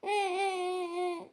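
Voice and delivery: put-on hushed little-girl whimpers; soft childish sniffling sobs; soft whimpering sobs. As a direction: whimper quietly like a child about to cry. Little girl starts crying.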